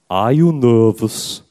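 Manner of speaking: slow, drawn-out imitation of an elderly pope; John Paul the Second. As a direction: impersonate the pontiff, taking each word slowly. Pope's quote acted out slowly.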